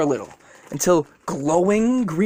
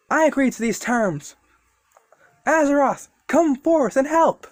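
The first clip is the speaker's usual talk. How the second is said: summoning call; 9 semitones higher than usual. Acted. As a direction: cry out the summons. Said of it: "Deku's like".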